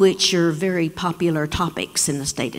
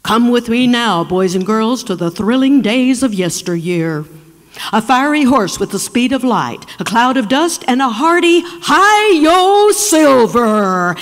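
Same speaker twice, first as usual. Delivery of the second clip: Lone Ranger radio intro; 5 dB louder than usual. Retold or imitated. Dad bursts into class announcing the Lone Ranger, booming. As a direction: call it out like the old radio show opening. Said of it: "I heard my daddy's baritone voice out there".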